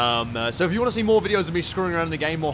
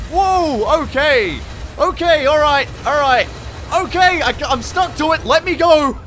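loud, high frantic yelps; alarmed shouting; 10 semitones higher, 7 dB louder than usual. Real, not acted. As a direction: yell while being dragged into the storm. Stuck to the tornado.

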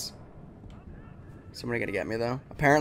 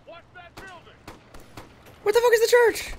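incredulous. Real, not acted. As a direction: yelp it in disbelief. Confused under fire.

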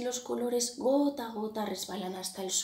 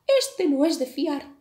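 much higher, louder parrot voice; the parrot distrusts the crow, higher and louder; 6 semitones higher, 8 dB louder than usual. A narrator's reading. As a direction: voice the suspicious parrot.

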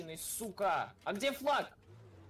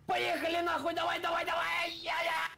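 excited shouting; 7 semitones higher than usual. Real, not acted. Urging driver to floor it.

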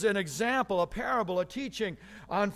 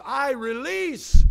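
booming declaration; 6 semitones higher, 12 dB louder, much slower than usual. Acted. King's words, contrasted with grab.

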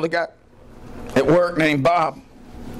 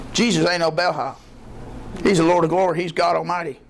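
usual speaking voice

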